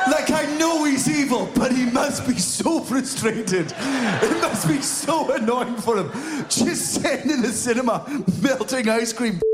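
high, laughing pity; amused high-pitched commiseration; mock sympathy. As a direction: plead his case in a high voice. Mock pity for the failed culprit.

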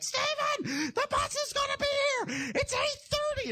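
high-pitched yell, imitating a mom rousing her kid; mock mom hollering up to wake her son; mom's wake-up yell. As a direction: call out like a mother at bus time. Mom yelling a child awake.

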